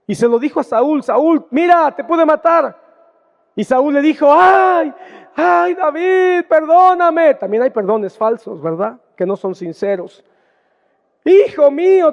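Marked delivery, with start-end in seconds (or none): 1.0-2.8 higher, louder call across a distance. Retold or imitated. David calling out to Saul. 4.3-7.3 much higher, louder, slow whining plea; hammed-up begging, stretch the ay; pleading Saul. Saul begging David's forgiveness. 11.2-12.1 higher, louder mock-sweet call; false tenderness. Saul's false tender words.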